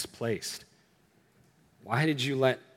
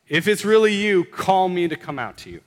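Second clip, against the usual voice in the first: raised, eager call to Jesus; calling out across water; 5 semitones higher, 4 dB louder than usual. Acted. Peter in the boat calls out to Jesus, raised and eager. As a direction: shout the plea over the waves.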